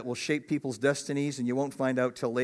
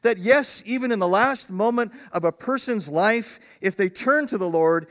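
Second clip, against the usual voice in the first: indignant preaching; 7 semitones higher, 5 dB louder than usual. Retold or imitated. Pastor answers critics of deathbed conversions.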